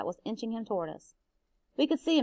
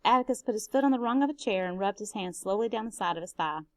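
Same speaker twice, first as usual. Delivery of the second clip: usual speaking voice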